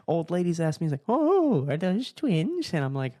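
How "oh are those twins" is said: high, slow mock old woman; imitating an old lady asking. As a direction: gush like a curious grandma. Mimicking old lady's question.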